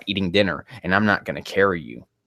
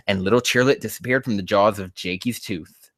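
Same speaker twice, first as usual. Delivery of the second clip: ordinary talk, nothing marked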